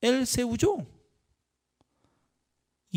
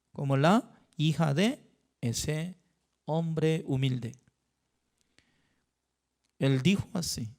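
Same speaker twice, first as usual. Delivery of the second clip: ordinary talk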